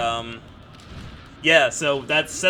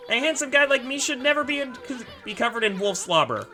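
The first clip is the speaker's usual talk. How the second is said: higher, boastful little sprite; cocky bug voice; 11 semitones higher than usual. Acted. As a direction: play a vain tiny artist.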